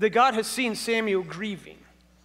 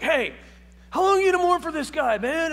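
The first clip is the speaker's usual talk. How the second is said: loud, high, fast impatient demand; harsh scolding God; 9 semitones higher, 7 dB louder than usual. Retold or imitated. How the preacher always read God scolding Samuel, loud and impatient. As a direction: bark it like an exasperated boss. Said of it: "I was always like".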